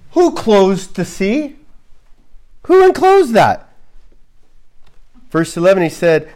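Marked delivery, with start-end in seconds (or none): 0.1-3.7 rising to a high incredulous cry; God challenging. Acted. God challenging Job about the sea, incredulous. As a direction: demand who did it.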